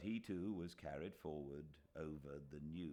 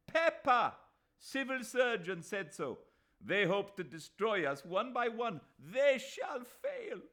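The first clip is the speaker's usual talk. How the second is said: defiant shout; 12 dB louder than usual. A narrator's reading. Mahmud Ali vowing to the crowd, defiant shout. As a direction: hurl it at the enemy.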